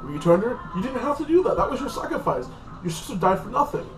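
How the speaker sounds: high-pitched imitated taunt; hammed-up, high-pitched needling; mock taunting. Elder taunting in retold scene.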